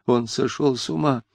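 high, distraught appeal, building; panicked babbling. A narrator's reading. Mother appealing to investigator about her son, panicked. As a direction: babble in rising alarm. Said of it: "невразумительно лепетала Чепурнова".

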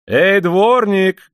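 high, loud, drawn-out hail; calling out. A narrator's reading. Worker calling the yardkeeper at the gate, long loud shout. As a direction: call the yardkeeper from across the yard. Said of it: "крикнул он".

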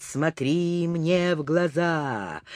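soft, slow hypnotic «Смотри мне в глаза»; hypnotic murmur. Acted. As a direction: say «Смотри мне в глаза» softly and slowly, stressing «мне». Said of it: "стал негромко повторять".

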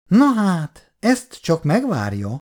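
a light scolding lilt, high start then slow; mild reproach. A narrator's reading. Olgi néni to Bondi, a mild reproach. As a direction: gently chide him for wanting to leave. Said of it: "mondta enyhe korholással".

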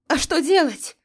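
high, loud desperate shout; shouting over wind. Acted. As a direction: yell the question into the gale. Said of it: "прокричала я".

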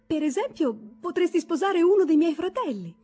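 high, loud excited proposal; eager suggestion. Acted. Toni excitedly suggests Gerda marry her brother, high and loud. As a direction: pitch the idea with girlish excitement.

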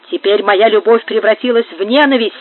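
ordinary delivery

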